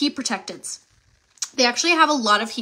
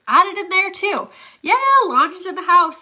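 excited; 7 semitones higher, 4 dB louder than usual. Real, not acted. Hyping the livestream giveaway.

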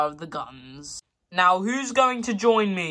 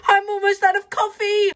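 much higher, whining repeat; high whine; 14 semitones higher, 4 dB louder than usual. Acted.

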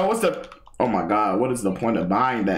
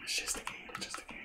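fading whisper, repeated to yourself; whispered mantra; 17 dB quieter, much faster than usual. Real, not acted. Streamer calming himself over a game, whispering. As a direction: whisper it, softer each time.

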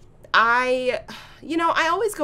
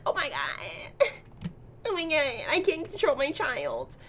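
a put-on wailing mother, softer; mock distraught mom; 5 semitones higher, 5 dB quieter than usual. Vlogger voicing the mother whose daughter fought her, mock despair. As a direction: whimper like a helpless mom. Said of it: "Look at her mom's face. She's like".

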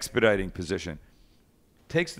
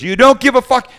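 much higher, much louder yelling; furious yell; 12 semitones higher, 14 dB louder than usual. Retold or imitated. Chef screaming at the cook before throwing the peach, enraged. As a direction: explode at him in rage. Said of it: "He goes".

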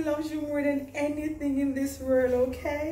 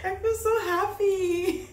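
slow, high, half laughing, half crying; overwhelmed with joy, tears breaking in; laughing through tears; 8 semitones higher, slower than usual. Real, not acted. Emotional dress moment, laughing crying.

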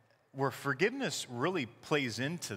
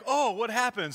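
alarmed; 8 semitones higher, 4 dB louder than usual. Retold or imitated. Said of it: "And it's like".

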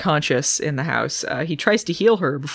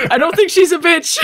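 louder, laughing correction; laughing backpedal; 10 semitones higher, 6 dB louder than usual. Real, not acted. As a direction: hurry to take it back, laughing. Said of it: "I didn't mean to say that".

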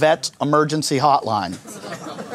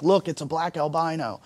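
hushed diversion; 8 dB quieter than usual. Retold or imitated. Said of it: "I'm like".

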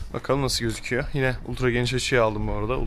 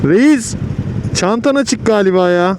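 shouting over traffic; loudly calling to a rider; 8 semitones higher, 9 dB louder, slower than usual. Real, not acted. Motorcyclist calls to another rider. (yüksek sesle seslenme)

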